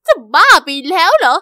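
loud shocked are-you-crazy; incredulous outburst. Acted. Girl reacting to a teen proposal, loud incredulous outburst. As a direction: blurt it out in disbelief.

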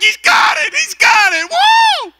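very high, screamed celebration with a whoop; hammed-up revival shriek at full volume; ecstatic pastor's shout. Pastor rejoicing over faked tongues, high ecstatic shouting.

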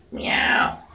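tiny breathy cat meow; hushed mock meow; whispered meow.

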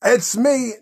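ordinary talk

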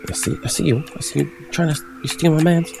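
mock accusation. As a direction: build to a high jealous accusation. Accusing an imagined rival.

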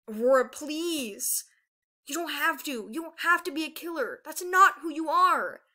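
loud, high, drawn-out plea; desperate pleading. A narrator's reading. Peril begging her sister not to kill, desperate. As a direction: beg urgently, stretching the words.

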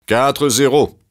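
high, loud score call; Zeus gloating over the score; trumpeting. Acted. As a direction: blare it out proudly. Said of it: "claironna Zeus".

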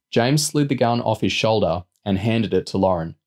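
ordinary delivery, nothing marked

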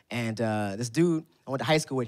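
usual speaking voice

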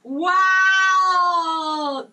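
long, very loud wow; amazed. Real, not acted. Streamer opening luxury hot chocolate, loudly amazed. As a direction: belt out a stretched, awestruck cry.

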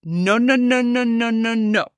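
ranty, insistent repeated no; emphatic, ranty no. Shown as a demonstration. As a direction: shoot it down with a run of insistent noes. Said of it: "a very emphatic no to that, a very ranty no".

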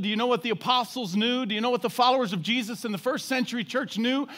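usual speaking voice

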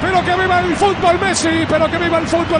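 rising excited goal call; breakneck goal commentary. Real, not acted. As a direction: shout the goal with joy.

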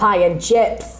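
lightly mocked broad northern accent; a touch of broad northern, at everyday pitch. Saying she is northern but not that broad, mild mock northern accent. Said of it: "I'm, I'm northern".

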